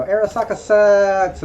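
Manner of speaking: drawn-out mocking avatar voice. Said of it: "avatar voice".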